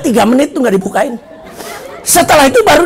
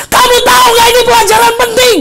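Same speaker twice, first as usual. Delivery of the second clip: high, loud, hammed-up teacher's rebuke; angry scolding; 12 semitones higher, 5 dB louder than usual. Teacher to late student, scolding angrily. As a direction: berate the latecomer at high pitch.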